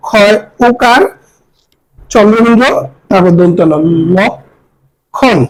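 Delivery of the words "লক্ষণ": "লক্ষণ" slowly as lok, long pause, khon; syllable-split demo. Shown as a demonstration. Teacher summing up the spelling, "লক্ষণ" split in two. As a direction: pull the two syllables of "লক্ষণ" far apart. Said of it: "লক্ষণকে যেরকম উচ্চারণ করি".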